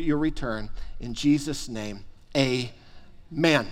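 prayer. Acted. Pastor leads congregation in prayer.

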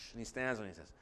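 normal talking voice

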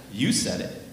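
YOU jumps out, the rest drops low; stress on YOU. Acted. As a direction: lean hard on YOU, handing the words back. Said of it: "Emphasis on the "you".

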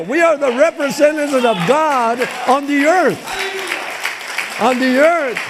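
impassioned slow proclamation; full-force preaching. Real, not acted. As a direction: proclaim it from the pulpit.

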